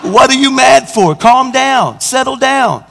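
high, emphatic mock pleading; acted-out exasperated appeal; exasperated retort. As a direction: protest loudly at an angry driver, playing it up. Retorts to the angry driver.

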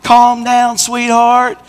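high, sing-out coaxing of an angry driver; exaggerated soothing retort; mock placating. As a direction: coax them like an upset child, hammed up. Placates the screaming woman driver.

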